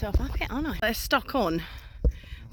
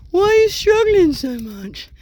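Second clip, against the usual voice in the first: imitating Dragan; 9 semitones higher, 9 dB louder, slower than usual. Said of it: "He's like".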